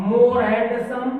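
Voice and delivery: very slow, word by word; drawn-out teacher dictation. Shown as a demonstration. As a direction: spell it out slowly as you write on the board. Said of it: "हम ये भी कर सकते हैं".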